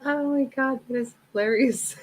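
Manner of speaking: words squeezed out through laughter; after laughing hard. Real, not acted. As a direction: still giggling, stomach aching from laughing.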